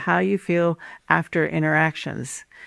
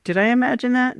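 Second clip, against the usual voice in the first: mildly doubtful question, pitched up; self-doubting; 7 semitones higher, faster than usual. Acted. Gaslit partner starts thinking after the conversation, mildly self-doubting. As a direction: wonder it to yourself, higher voice. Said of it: "you start thinking".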